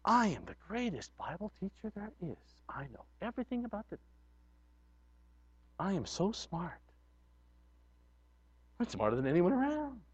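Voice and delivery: soft, self-satisfied boasting; hushed smug bragging; smug know-it-all. Pretend. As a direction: purr your own superiority. Arrogant Bible know-it-all voiced.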